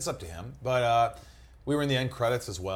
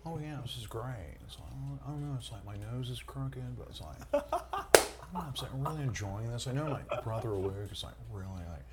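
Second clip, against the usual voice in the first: Owen Wilson impression; 2 semitones higher, 4 dB quieter than usual. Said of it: "what it would be like to have sex with Owen Wilson".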